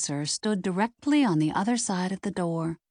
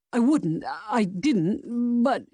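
higher, flustered stammer in an altered voice; 5 semitones higher, slower than usual. A narrator's reading. Accused man denying the killing to the sleuth, stammering. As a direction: start and break off, flustered. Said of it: "Prescott stammered".

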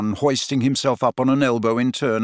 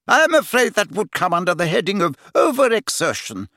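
high, brisk nurse; brisk matron voice; 7 semitones higher than usual. A narrator's reading. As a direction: bustle through it briskly. Madame Pomfrey speaking.

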